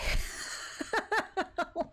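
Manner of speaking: high, breathy giggling fit; helpless laugh. Real, not acted. Streamer after her chaotic phone call, helpless laughter. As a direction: laugh it out in a long run.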